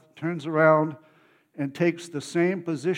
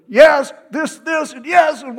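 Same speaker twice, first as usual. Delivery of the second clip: much higher and louder, swooping excited cries; mimicking interpreter; 12 semitones higher, 12 dB louder than usual. Interpreter copying the preacher's swooping voice, high excited shouts. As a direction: echo the preacher's rises like an eager interpreter. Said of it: "her voice is going up and down like mine".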